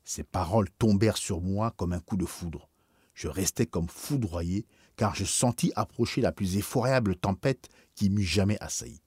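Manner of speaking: usual speaking voice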